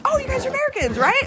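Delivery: excited classmate. Retold or imitated. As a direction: imitate an excited girl asking. Retelling an eager classmate's question.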